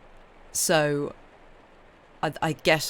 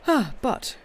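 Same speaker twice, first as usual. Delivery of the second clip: yawning; 9 semitones higher, 3 dB louder, slower than usual. Real, not acted. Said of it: "if I stopped yawning".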